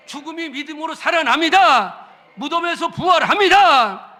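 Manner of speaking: loud, rising proclamation; blessing the congregation at full force; full-force preaching. Acted. Pastor blessing the congregation at full force.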